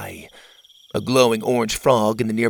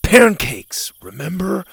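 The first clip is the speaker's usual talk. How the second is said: put-on boy character voice; 6 semitones higher, 3 dB louder than usual. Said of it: "Gavin said".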